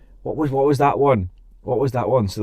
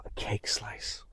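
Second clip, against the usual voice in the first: hushed whisper "a cake slice"; whispered reveal; 19 dB quieter, slower than usual. Shown as a demonstration. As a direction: breathe it out like a secret. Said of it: "Clap my Macau".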